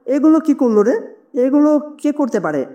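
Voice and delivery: a touch of amazement in the father's questions; mildly astonished. A narrator's reading. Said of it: "বাবা অবাক হয়ে".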